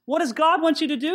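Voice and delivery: usual speaking voice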